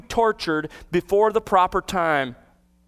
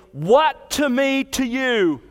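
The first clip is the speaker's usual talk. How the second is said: higher, slow mock scream; retold demon scream; 6 semitones higher, slower than usual. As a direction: cry it out like the demon, half acted. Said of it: "then screams".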